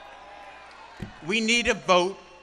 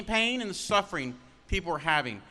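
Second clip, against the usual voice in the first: ordinary talk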